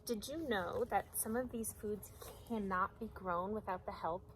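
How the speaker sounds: usual speaking voice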